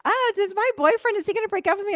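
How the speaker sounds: a fretting friend begging for an answer; worried teen girl. Retold or imitated. Friend anxiously asks about boyfriend.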